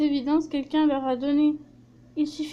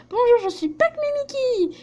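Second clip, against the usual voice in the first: high character voice; 9 semitones higher than usual. Acted. Character introduces herself.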